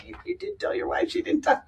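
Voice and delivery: high, slightly hushed worried question; imitated concerned disbelief. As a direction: imitate someone anxiously checking. Retells the client's worried question.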